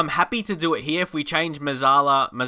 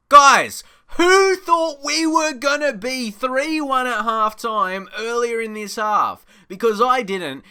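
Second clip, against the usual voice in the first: high, loud and slow with delight; excited call to the guys; thrilled; 9 semitones higher, 5 dB louder, slower than usual. Real, not acted. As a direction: share the joy with the viewers. Excited after going ahead.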